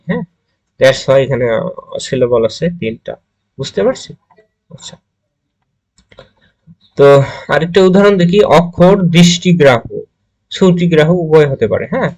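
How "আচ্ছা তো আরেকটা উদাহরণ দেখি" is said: ordinary delivery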